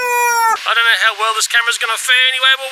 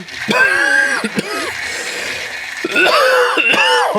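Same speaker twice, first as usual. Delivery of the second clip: real cough. Real, not acted. Reviewer who should be in bed coughs mid-sentence. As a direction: cough hard, unable to stop. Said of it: "I should be in bed".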